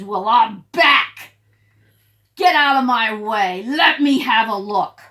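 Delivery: loud, slow bullying bark; rude and nasty. Acted. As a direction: push everyone aside with a loud rude bark. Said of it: "Oh, so rude and nasty".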